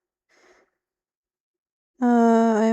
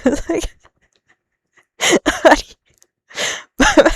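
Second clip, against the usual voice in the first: breaking into loud laughter mid-sentence; real laughter swallowing the words; laughing; 9 semitones higher, 8 dB louder, much slower than usual. Real, not acted. Laughing at the confusing line.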